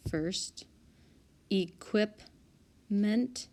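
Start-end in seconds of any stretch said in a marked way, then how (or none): 1.5-3.5 "Equipment" very slowly, with a long gap before ment; syllable by syllable. Shown as a demonstration. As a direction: sound out "Equipment" piece by piece. Sounds out word to find syllable.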